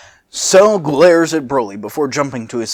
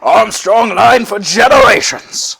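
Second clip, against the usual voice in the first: booming proud voice; 9 semitones higher, 8 dB louder, slower than usual. Acted. Acted voice switch.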